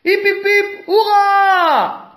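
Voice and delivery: very high, loud cheer; a crowd cheering the win; loud hip hip hurrah. A narrator's reading. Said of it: "acclame la foule".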